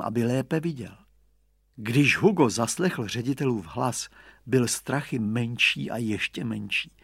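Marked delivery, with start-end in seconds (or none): none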